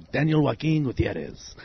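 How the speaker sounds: hushed telenovela-style name reveal; quiet, dramatic self-introduction; novella introduction. Shown as a demonstration. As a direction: say your name softly, with soap-opera flair. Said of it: "I usually say it like that 'cause it sounds like a novella".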